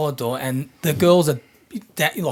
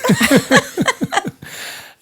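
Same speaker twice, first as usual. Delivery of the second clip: high laugh; 3 dB louder than usual. Real, not acted. Laughing at joke.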